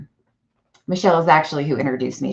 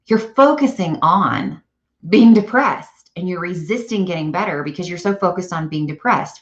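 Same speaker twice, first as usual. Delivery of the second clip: ordinary talk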